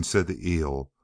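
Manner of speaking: ordinary delivery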